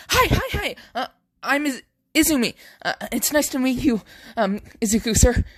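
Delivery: put-on high, eager girl's voice, quick; a girl greeting her hero, high and breathless with excitement; bubbly young girl. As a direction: gush out the intro like an excited new recruit. Girl character runs up, introduces herself.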